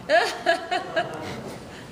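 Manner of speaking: light laugh. Real, not acted. Laughs at her own punchline.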